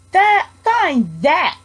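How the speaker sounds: sneering eagle, drawn out. A narrator's reading. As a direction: jeer it out slowly, mocking. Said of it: "sneered the bird".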